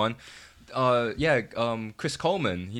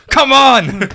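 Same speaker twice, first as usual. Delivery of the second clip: high, very loud rallying yell; exclaimed shout; 12 semitones higher, 17 dB louder than usual. Real, not acted. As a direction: yell it out at full volume. Exasperated cry about league hopes.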